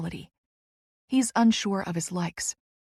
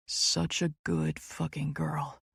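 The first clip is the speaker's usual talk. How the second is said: low, slow, softer dominant praise; 3 semitones lower, 6 dB quieter, slower than usual. A narrator's reading. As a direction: savour each word, softly and slowly. Dominant lover praises her.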